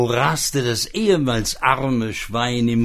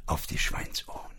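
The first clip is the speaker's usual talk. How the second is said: quick softer whispered punchline; 7 semitones lower, 9 dB quieter, faster than usual. Acted. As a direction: whisper the ending fast and sly. Magic pig ends with a hush.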